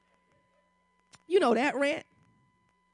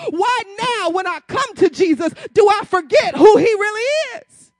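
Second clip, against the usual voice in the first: full-force preaching; 5 semitones higher, 8 dB louder than usual. Acted. Preacher to congregation, building to a loud climax.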